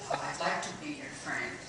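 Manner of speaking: usual speaking voice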